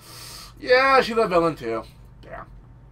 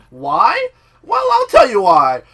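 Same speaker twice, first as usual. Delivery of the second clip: loud, high self-answered question; emphatic explaining; 7 semitones higher, 11 dB louder, slower than usual. Real, not acted. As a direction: ask it and answer it, loud and drawn out. Rhetorical question answered emphatically to viewers.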